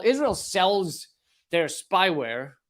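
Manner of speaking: normal talking voice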